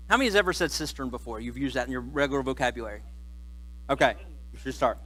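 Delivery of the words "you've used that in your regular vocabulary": usual speaking voice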